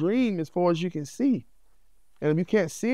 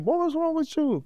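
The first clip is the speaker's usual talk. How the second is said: pitched way up, asking himself what's wrong; mock-baffled high voice at himself; high incredulous self-scold; 13 semitones higher than usual. Man baffled at himself for defending a partner, high incredulous voice.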